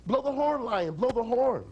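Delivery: high-pitched eager frog; excited urging. Acted. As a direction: egg a friend on, voice lifted. Frogs urge lion to blow.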